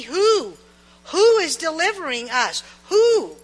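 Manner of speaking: loud, rising, insistent who; demanding shout. Acted. The people demanding of Moses who sent him, loud and insistent. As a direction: hurl the question back at Moses.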